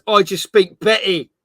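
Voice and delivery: ordinary talk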